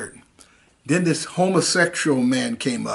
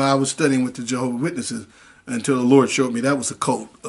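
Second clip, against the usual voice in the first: usual speaking voice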